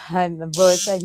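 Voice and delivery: ordinary talk, nothing marked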